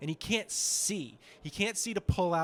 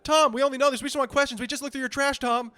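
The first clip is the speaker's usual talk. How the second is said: much higher, louder nagging; pestering paparazzi; 10 semitones higher, 8 dB louder than usual. Pretend. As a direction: hound him relentlessly. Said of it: "I'll be like".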